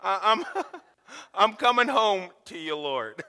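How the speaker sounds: high, laughing comic prayer; hammed-up farewell to the Lord; high giggly mock surrender; mock resignation to death. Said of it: "I was like".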